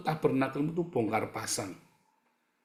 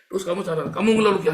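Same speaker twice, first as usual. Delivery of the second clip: high, loud, fast rebuke of grumbling; fiery preaching; 8 semitones higher, 10 dB louder, much faster than usual. Acted. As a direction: hammer the congregation with it. Preacher peaks on complaining. (khotbah berapi-api, tinggi dan lantang)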